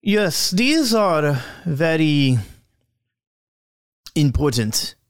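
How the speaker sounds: slow, deliberate character voice; measured words with long, weighty pauses; Oksana. Acted. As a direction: draw each word out, pausing before the last ones. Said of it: "and she goes".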